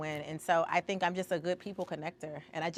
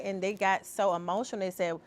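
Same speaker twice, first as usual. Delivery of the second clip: ordinary talk, nothing marked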